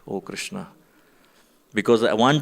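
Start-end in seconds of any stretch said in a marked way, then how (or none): 0.1-0.7 soft, low groan of dismay; dismayed sigh. Retold or imitated. Seeing the broken Shila on the floor, hushed dismay. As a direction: let it drop out of you in dismay.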